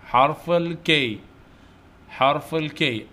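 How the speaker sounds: drawn-out, measured naming; slow letter drill. Shown as a demonstration. Teacher naming the letter K for young pupils, slowly. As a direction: stretch it out for pupils to repeat.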